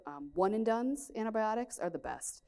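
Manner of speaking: ordinary delivery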